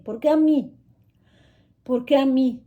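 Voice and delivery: "¿Por qué a mí?" cried out high and loud; anguished cry. Retold or imitated. Mother far from her children cries out at fate, loud anguished wail.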